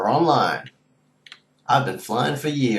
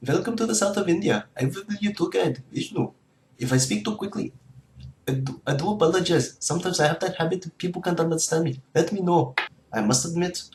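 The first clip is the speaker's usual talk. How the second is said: put-on lightly Indian-accented tour guide; chatty Indian guide, speeding up at times; 3 semitones higher than usual.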